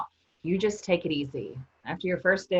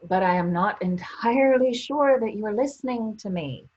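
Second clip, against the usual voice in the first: exasperated; 5 semitones higher, 4 dB louder than usual. Acted. Exasperated with pestering Madge.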